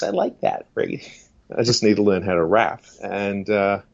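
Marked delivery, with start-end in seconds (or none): 0.0-1.1 very high-pitched co-host voice; Easy. Acted. Co-host chatting to the evangelist, very high-pitched impression. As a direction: squeeze it way up high. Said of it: "he's got a very high-pitched voice".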